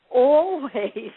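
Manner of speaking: "always" repeated, long and drawn out; emphatic ALWAYS. Real, not acted. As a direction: hammer home "always" with a long stretch.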